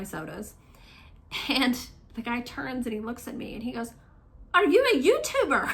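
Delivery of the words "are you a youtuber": high-pitched, louder mock-stranger question; amused astonishment. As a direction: retell it high, on the edge of laughter. Retold stranger recognising her, with laughter.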